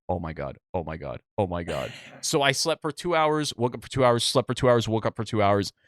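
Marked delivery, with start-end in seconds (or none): 0.1-1.9 retold dread. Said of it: "And I go".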